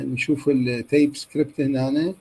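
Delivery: normal talking voice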